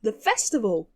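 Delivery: ordinary talk, nothing marked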